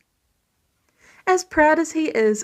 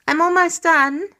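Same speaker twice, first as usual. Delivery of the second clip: higher, louder call from another room; 6 semitones higher, 4 dB louder than usual. A narrator's reading. Peter calling to Tony from the kitchen, raised voice. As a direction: call it across the apartment. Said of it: "Peter calls from the other room".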